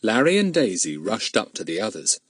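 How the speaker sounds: ordinary delivery, nothing marked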